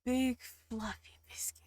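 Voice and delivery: soft whisper; breath-only, quiet relish; hushed savouring. Real, not acted.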